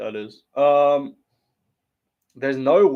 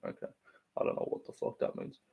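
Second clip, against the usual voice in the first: whispered aside; 14 dB quieter than usual. Real, not acted. Muttered aside.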